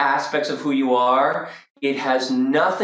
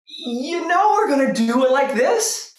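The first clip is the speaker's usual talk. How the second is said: high, elated put-on voice; lifted, excited revelation; higher self; 11 semitones higher than usual. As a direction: voice an inspired inner self. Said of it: "rises all the way up to the higher self that says".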